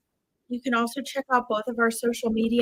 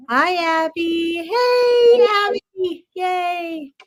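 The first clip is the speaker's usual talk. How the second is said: high, bright hello to a friend; excited greeting; 5 semitones higher, 3 dB louder, much slower than usual. Real, not acted. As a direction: call out a happy welcome. Greeting a viewer who joins.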